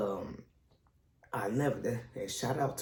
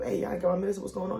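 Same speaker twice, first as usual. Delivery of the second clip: higher-pitched anxious phone query; retold worry; 7 semitones higher, faster than usual. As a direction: act out a worried call about medicine. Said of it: "I'm worried about my medicine".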